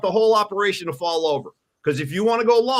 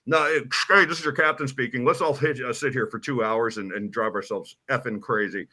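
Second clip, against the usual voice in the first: lightly voiced pilot announcement; 6 semitones lower than usual. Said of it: "It went like this".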